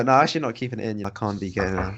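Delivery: ordinary talk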